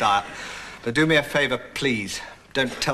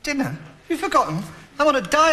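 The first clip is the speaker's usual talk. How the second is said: high-pitched affronted protest; indignant reminder; 11 semitones higher, faster than usual. Acted. Reminding friend of his diet.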